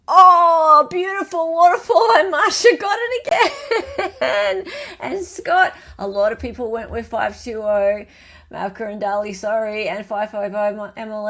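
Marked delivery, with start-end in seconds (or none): 0.1-5.7 high, loud delighted outburst; excited laughing. Real, not acted. Host announcing the quiz winner, excited and laughing. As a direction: announce it gleefully, breaking into laughter.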